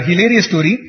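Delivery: normal talking voice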